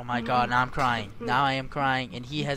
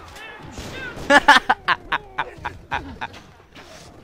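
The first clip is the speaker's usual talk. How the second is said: long staccato laugh, loud then fading; hard laughter; 3 semitones higher, 8 dB louder than usual. Real, not acted. Player cracking up at game chaos, long laugh. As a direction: burst out laughing and let it trail away.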